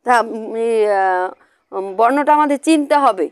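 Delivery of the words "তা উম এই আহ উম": ordinary talk, nothing marked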